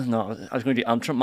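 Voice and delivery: ordinary delivery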